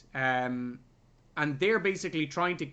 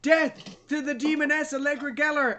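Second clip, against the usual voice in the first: much higher, put-on fanatic's proclamation; mock battle cry; 10 semitones higher, 4 dB louder than usual. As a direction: declaim it like a crazed zealot. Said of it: "he turns the gun and goes".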